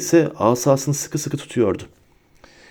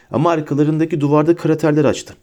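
usual speaking voice

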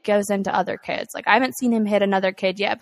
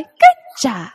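imitating toddler; 4 semitones higher, 10 dB louder than usual. Imitating toddler praising himself.

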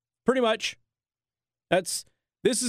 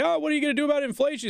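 much higher and fast, breezy put-on voice; mock reporter; 9 semitones higher, much faster than usual. Host mimics reporter asking Biden about inflation, high and fast. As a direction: rattle it off like a giddy reporter.

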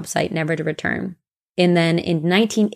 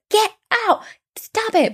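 much higher mock shout at the kid; mom yelling. Mom in the next stall yelling at her son, high mock shout. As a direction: shoo the child out, high and sharp. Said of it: "his mom was like".

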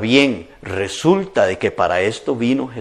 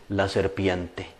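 sheepish excuse; 5 semitones lower, 9 dB quieter, slower than usual. Retold or imitated. Eve blames serpent.

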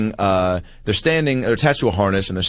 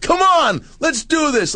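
cheering shout; slower than usual. Retold or imitated. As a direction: cheer her on from the stage. Host cheering woman on.

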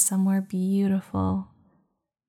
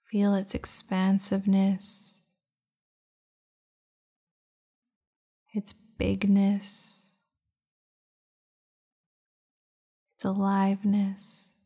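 guided meditation. Acted. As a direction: guide them into calm, unhurried. Leads a meditation.

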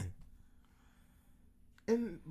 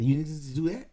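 normal talking voice

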